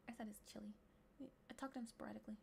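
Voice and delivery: soft whispered reading; whispering to oneself. Real, not acted. Streamer mulling over quiz options, whispering. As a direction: read it under your breath. Murmurs answer options while thinking.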